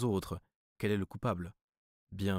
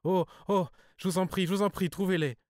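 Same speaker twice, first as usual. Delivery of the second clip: much higher and louder, a pleading cry of pain; anguished cry; 11 semitones higher, 5 dB louder than usual. A narrator's reading. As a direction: beg him desperately, voice climbing high. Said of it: "une sorte de cri de souffrance".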